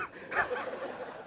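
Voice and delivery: quiet unvoiced chuckle; soft laugh. Real, not acted. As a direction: laugh under the breath at the punchline. Laughs at own joke.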